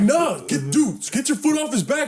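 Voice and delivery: high, insistent plea to a cop; retold defiant protest.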